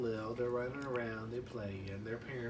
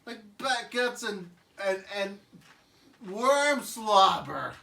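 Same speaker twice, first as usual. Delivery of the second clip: higher, louder, slow ending; gross-out Phil; 7 semitones higher, 10 dB louder, slower than usual. Acted. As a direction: drag out the gross list. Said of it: "Phil's like".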